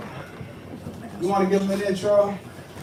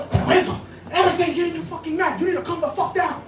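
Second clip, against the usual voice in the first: scolding grandmother; 7 semitones higher, 3 dB quieter, faster than usual. Retold or imitated. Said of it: "She's like".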